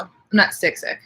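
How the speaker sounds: usual speaking voice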